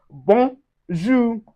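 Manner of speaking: "bonjour" slowly as bon, zhoo; nasal ON. Shown as a demonstration. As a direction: split "bonjour", nasal vowel in the first syllable. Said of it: "it gives us un".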